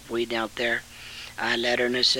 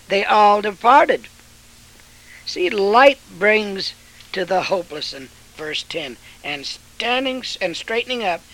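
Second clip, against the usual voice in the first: loud, high fiery sermon voice; preaching at full force; 4 semitones higher, 6 dB louder than usual. Acted. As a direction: thunder it from the pulpit.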